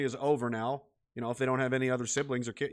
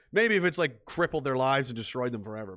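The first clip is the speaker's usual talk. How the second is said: ordinary talk, nothing marked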